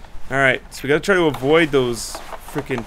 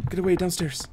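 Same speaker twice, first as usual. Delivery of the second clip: ordinary delivery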